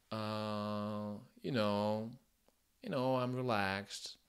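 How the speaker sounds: slow, loose-jawed American "you know, I'm relaxed"; relaxed American accent. Shown as a demonstration. Teacher demos a relaxed American accent. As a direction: let the jaw hang in a relaxed American accent. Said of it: "C'est un accent qui est détendu".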